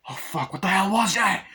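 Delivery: alarmed shout. Retold or imitated. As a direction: yell in horror at what you saw. After torching the creature.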